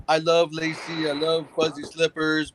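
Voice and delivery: slow, put-on sappy drunk voice; mock drunk affection. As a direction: imitate the drunk's soppy love talk.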